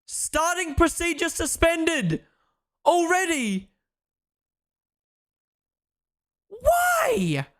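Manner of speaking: high, incredulous frustrated cries; venting at the race delay; exasperated outburst. Real, not acted. Race suspended, frustrated streamer.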